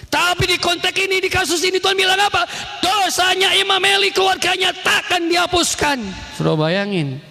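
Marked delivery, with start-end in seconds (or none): 0.1-6.0 high-pitched, ringing declamation; full-force preaching. Real, not acted. Preacher declares Eli's sin unforgiven, high impassioned preaching.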